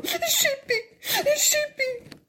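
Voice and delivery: whimpering. A narrator's reading. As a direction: sob it like a small child. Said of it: "contestó Chinchaté lloriqueando".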